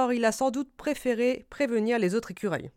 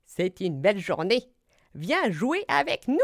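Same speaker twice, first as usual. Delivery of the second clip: storybook fox with swooping pitch; Renard; 4 dB louder than usual. Acted. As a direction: coax a friend to play, rising on the invitation. Renard invite à jouer. (voix de renard qui invite)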